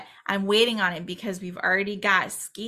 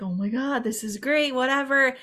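imitating excited fans, higher pitch; lightly gushing mock fan reaction; 5 semitones higher than usual. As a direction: play at being a thrilled fan. Acting out thrilled Scream fans.

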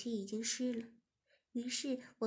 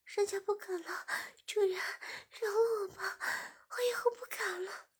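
high pleading; 9 semitones higher than usual. Acted. Shiya promising her master she won't again, high and begging.

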